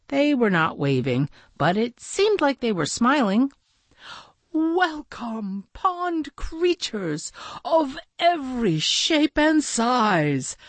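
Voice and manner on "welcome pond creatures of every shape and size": high, stately welcoming announcement; calling out. A narrator's reading. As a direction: greet the crowd like a master of ceremonies. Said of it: "Maxwell called out".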